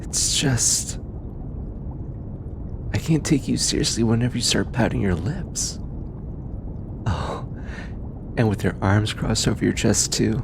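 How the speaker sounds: lightly voiced researcher, fond and teasing, with thoughtful pauses. Researcher thanking their stubborn bodyguard, fond and sincere. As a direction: talk gently to a trusted companion, unhurried, hesitating between phrases.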